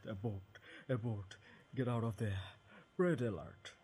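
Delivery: loud panicked shouting; panicked alarm. Acted. Panicking inner voice.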